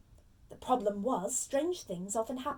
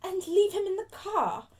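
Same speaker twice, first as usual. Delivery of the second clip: high, louder, musing aloud; slow, reluctant; 9 semitones higher, 7 dB louder than usual. Acted. Aunt finishing her plan for her nephew, slow and reluctant. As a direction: add it as a grudging afterthought. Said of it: "said Aunt Petunia slowly".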